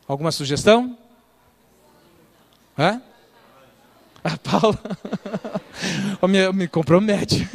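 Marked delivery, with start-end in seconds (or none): 4.8-6.2 a short, soft chuckle; quiet amused laugh. Real, not acted. Audience laughter at guess.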